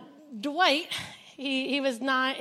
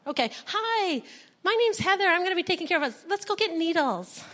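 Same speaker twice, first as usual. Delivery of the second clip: put-on high, bright-intent greeting to the kids; cheery caretaker; 6 semitones higher than usual. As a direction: sell the needle trip like a perky nurse. Introducing herself to new kids.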